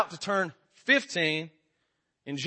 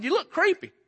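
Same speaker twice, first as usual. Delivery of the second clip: put-on blunt old lady; 11 semitones higher than usual. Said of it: "She said".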